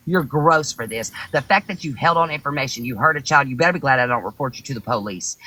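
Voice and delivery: ordinary delivery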